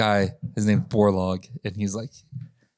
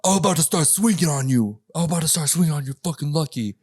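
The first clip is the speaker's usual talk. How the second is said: high, put-on threat from a sore loser; pissed off threat; 6 semitones higher than usual. Angry wrestler threatening the narrator after tapping out, high mock rage. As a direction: play the angry wrestler, voice pitched up, mocking him. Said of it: "He was pissed off".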